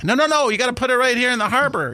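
high-pitched, hammed-up protest; mock insistence. Imagined gift giver objecting to where it goes, mock insistent.

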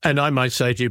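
normal talking voice

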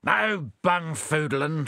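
slow, stern warning. Acted. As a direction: lay down the law slowly. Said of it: "said Bill sternly".

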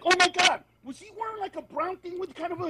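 high, excited mock gasp of recognition. Other comedians reply to their friend, in excited mock recognition. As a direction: shoot up high as if you know exactly who.

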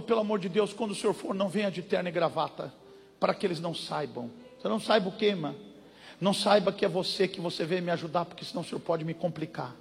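mimicking a scared woman in a low murmur; hushed frightened sister. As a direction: hush it like she fears being overheard. Pastor voices frightened church sister.

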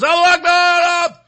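high, loud mock battle cry; imitated yell. Host imitating the suitcase prankster, high mock yell.

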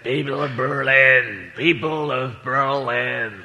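slow, low, drawn-out presidential address; Kennedy impression. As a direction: deliver it like a grand statesman at the Wall. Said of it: "I can't do President Kennedy as an impression".

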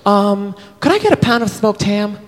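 put-on high, sweet girl ordering at the deli; mock girl at the deli counter; girly voice. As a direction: ask for ham in a high girlish voice. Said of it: "she goes".

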